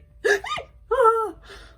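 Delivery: sharp, high gasp of disbelief; a real gasp that breaks into a cry; loud shocked gasp. Real, not acted. Pulls a rare card.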